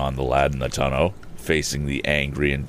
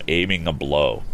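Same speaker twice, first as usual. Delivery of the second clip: plain reading voice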